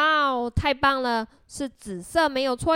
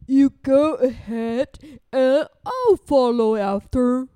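ordinary delivery, nothing marked

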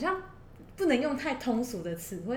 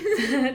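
laugh; 4 semitones higher, 8 dB louder than usual. Real, not acted. Laughing at the comment.

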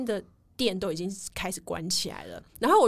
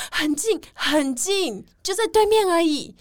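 very slow, higher coaxing voice; imitating the reassuring guide; 9 semitones higher, 6 dB louder, slower than usual. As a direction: drag it out to talk them into it. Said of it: "他就说". (学他慢吞吞地哄人说很近)

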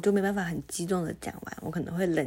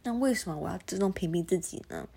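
ordinary talk

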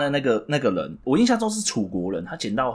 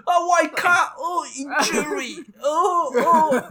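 high, drawn-out hammed-up wail; mock wailing in pain; 14 semitones higher, much slower than usual. S0 acting the footless man rolling on the floor, mock wail. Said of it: "就会像你这样".

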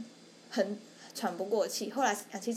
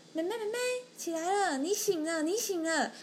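nurse waking a patient; 9 semitones higher than usual. Retold or imitated. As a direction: coax a patient awake with a lightly voiced nurse. 护士阿姨叫醒病人. (用高音轻轻唤醒病人的护士阿姨)

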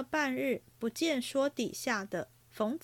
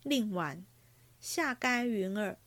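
usual speaking voice

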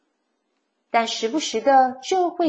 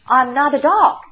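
raised, louder protest; indignant retort; 4 semitones higher, 8 dB louder than usual. A narrator's reading. Coyote answering the cactus's insult, indignant. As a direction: protest it with offended emphasis.

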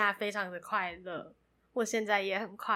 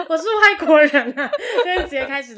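loud, high embarrassed laughter; laughing; 8 semitones higher, 16 dB louder than usual. Real, not acted. Embarrassed laughing apology, foreigner joke.

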